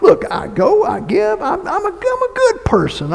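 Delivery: high, mocking self-satisfied whine; mock the smug churchgoer; imitating a self-righteous person. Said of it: "And you think".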